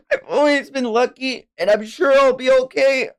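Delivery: put-on high, earnest child voice; brave young child. Child telling an elder not to save them, high and earnest. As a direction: reassure the elder in a kid's voice.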